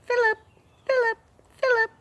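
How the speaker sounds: very high chirpy bird calling its name. Acted. Parrot calling Philip over and over, high bird voice. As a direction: squeak it like a little bird. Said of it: "without ceasing".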